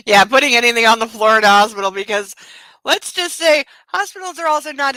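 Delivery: loud laugh into the words. Real, not acted. Laughing hard as she comes back to the floor comment. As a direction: laugh hard, then talk on quickly.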